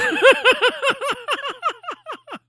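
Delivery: high burst of laughter; big laugh. Real, not acted. Laughing hard at a savage line from the drunk group. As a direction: cracks up at the joke.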